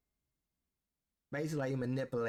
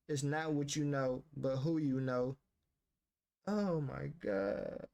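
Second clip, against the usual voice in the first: normal talking voice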